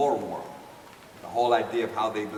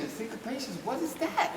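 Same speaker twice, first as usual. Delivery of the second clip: baffled; 9 semitones higher, 6 dB quieter than usual. Retold or imitated. Baffled by the jargon.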